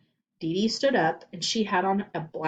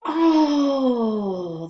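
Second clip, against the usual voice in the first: high loud disappointed groan; class groan; 6 semitones higher, 8 dB louder than usual. Acted. The class dismayed at the ripped tie, groaning. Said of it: "the whole class groaned".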